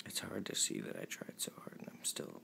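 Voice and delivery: near-whispered, tearful and quiet; fighting not to cry; holding back tears. Real, not acted. Telling viewers about the breakup, tearful hush. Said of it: "not cry".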